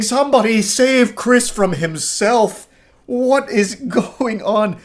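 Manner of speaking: high, slow pleading; pleading disbelief. Real, not acted. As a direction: beg someone to save him. Reacting to his message.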